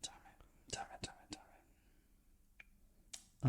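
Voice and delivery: barely audible repeated whispered curse; frustrated whisper. Real, not acted. Podcaster torn over his film list, whispering. Frustrated muttering while choosing films.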